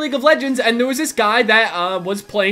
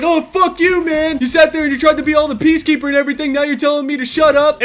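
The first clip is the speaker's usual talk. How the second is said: higher-pitched mock angry gamer; hostile retort; 4 semitones higher, 3 dB louder than usual. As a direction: imitate an indignant teammate lashing out. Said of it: "started saying terrible things to me".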